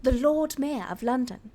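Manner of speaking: lightly put-on posh British; hoity-toity British. Podcaster defending her accent for the Lord Mayor, hoity-toity British. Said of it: "it was supposed to be".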